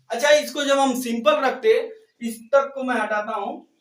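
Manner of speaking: usual speaking voice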